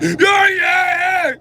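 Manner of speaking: high, loud yell; demo yell. Shown as a demonstration. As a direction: bellow it. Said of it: "So just be like".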